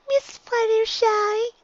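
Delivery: high, slow little filly voice; young filly voice. A narrator's reading. Dinky answering her teacher in a high filly voice. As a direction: play a small shy filly.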